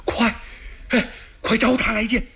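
high, urgent command; eager order. Acted. Emperor ordering the messenger brought in, urgent high call.

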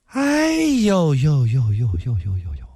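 a long rueful sigh. Acted. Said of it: "至尊宝叹了口气".

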